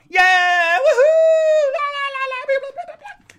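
high loud woohoo into sung la la; joyful shout. Real, not acted. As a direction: shriek then sing-song babble. Celebrating the move.

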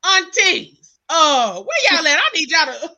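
high, loud call to the aunties. Retold or imitated. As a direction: shout across the room for the aunties. Calling out to aunties.

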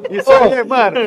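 high laughing cheer. Real, not acted. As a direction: laugh it out, mocking friend. Laughing at friend's bad hand. (rindo alto)

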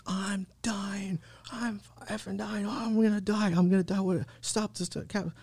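high, thin, held-back panic scream; mock screaming. Panicked passenger begging the cabbie to stop, mock screaming. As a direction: imitate a desperate scream without full volume. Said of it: "I start screaming on top of my lungs".

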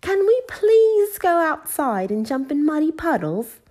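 very high, loud eager plea; Peppa, excitedly. A narrator's reading. Peppa to her parents early next morning, excited. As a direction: beg like a thrilled little girl. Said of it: "asked Peppa excitedly".

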